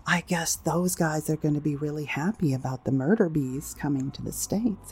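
normal talking voice

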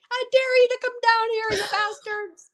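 high, shouted taunt upward; yelling at the sky. Retold or imitated. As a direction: dare them, yelling at the sky.

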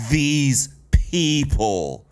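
slow, mocking echo of disgust. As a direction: drag out both words with contempt. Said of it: "listen to the disgusted".

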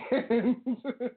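laugh. Real, not acted. Laughing at own stumble.

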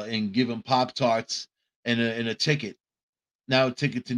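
ordinary delivery

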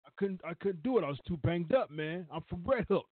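playful imitation in a Red Hook accent; Taz, Red Hook accent. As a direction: protest like a Red Hook wrestler. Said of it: "That's my Red Hook accent".

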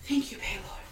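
breathy whisper of a grateful prayer; whispered thanks. Acted. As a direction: murmur thanks to your god under your breath.

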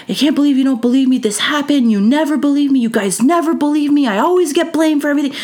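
fast, high-pitched angry protest, building; retold mad outburst. Retelling the boy's protest to his teachers, mad and rising. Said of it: "he was mad".

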